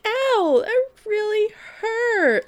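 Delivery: high, slow whimper of pain; high whiny hurt voice. Retold or imitated. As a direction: whine it like a hurt girl.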